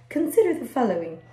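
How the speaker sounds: a light hint of Bill Nye's TV host voice; lightly voiced science show host announcing; passing Bill Nye impression. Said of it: "Bill Nye the Science Guy where it says".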